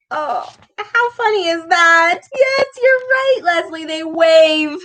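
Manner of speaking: high, gleeful congratulation; high-pitched excited amusement; delighted praise. Real, not acted. Teacher cheers a correct answer.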